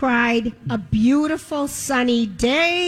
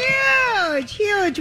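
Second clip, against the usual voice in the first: excited; 8 semitones higher than usual. Real, not acted. Repeating with big emphasis.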